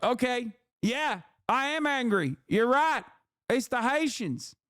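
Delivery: playing the sucker who falls for the pitch; mock gullible voter. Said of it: "And they're like".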